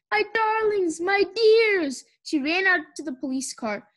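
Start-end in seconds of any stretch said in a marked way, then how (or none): 0.1-2.0 high, gushing endearments; effusive welcome. A narrator's reading. Missus Watson greeting her returning family, effusive. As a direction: gush over loved ones.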